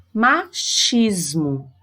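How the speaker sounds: "Machismo" very slow, with a long sh; slow teacher's demo of "Machismo"; CH sound demonstrated. Shown as a demonstration. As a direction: break "Machismo" as ma-chismo, stretching the sh. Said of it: "Qual é o som desse C H?".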